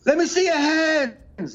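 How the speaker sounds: high, drawn-out singer calling to the crowd; imitated stage shout. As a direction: holler it slowly, mimicking a rock frontman. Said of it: "he would be like".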